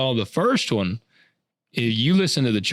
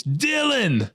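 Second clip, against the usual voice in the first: Arnold greeting his buddy, higher and slow; Arnold impression; 6 semitones higher, much slower than usual. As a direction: ham up Arnold's big hello. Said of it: "so he goes".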